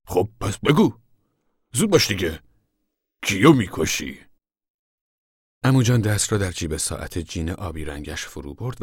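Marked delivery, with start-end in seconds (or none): 0.1-4.3 louder, exasperated prodding; impatient urging. A narrator's reading. Father prods uncle to confess, impatient. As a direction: push him loudly to get on with it.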